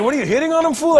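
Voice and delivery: high, loud voice of an incredulous friend; Gabe. Retold or imitated. Friend challenging his drunk buddy's compliments, high and incredulous. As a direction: pitch it way up, challenging him. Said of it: "And Gabe was like".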